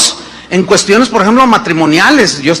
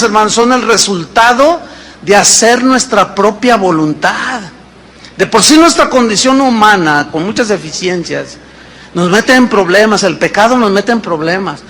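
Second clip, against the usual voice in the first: ordinary talk, nothing marked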